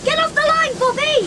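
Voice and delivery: yank her off the track with your voice; urgent shout. Acted. Big sister yells at Bobby on the line, frantic shout.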